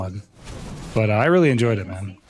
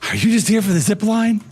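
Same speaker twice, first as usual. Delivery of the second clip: high, lightly voiced skit character; imitating the skit voice; 9 semitones higher than usual. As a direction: echo the skit line in a higher voice. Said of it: "I love that skit".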